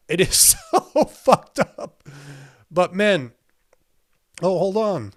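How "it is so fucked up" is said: slow, higher, louder through a laugh; laughing disbelief. Real, not acted. Streamer laughing at the scandal, slow disbelief. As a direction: stretch it out laughing in shock. Repeats line, then laughs.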